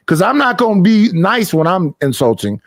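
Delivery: normal talking voice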